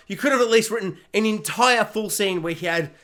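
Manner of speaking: usual speaking voice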